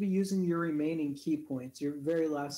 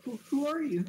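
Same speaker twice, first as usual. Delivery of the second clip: startled; 8 semitones higher, 4 dB louder than usual. Acted. Servant NPC startled by intruders.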